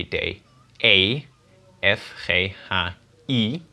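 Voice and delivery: normal talking voice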